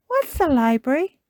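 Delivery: put-on high, loud child's question; Peppa Pig. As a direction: ask like a curious little piglet. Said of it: "asks Peppa".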